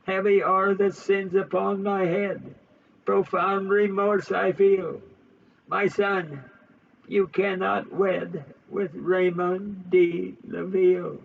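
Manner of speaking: a hint of a father weighed down by sin; solemn Marquis lamenting; remorseful old Marquis. Marquis confessing in held voice.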